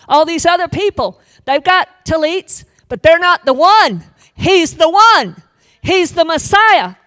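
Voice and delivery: high, loud preaching cry; preaching at full force. Acted. Preacher declaring to the congregation, high and loud.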